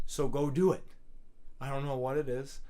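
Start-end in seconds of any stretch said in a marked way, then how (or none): 0.1-0.8 loud motivational push; Shia LaBeouf. Real, not acted. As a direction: urge them on forcefully, like a pep talk. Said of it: "I sound like fucking Shia LaBeouf now".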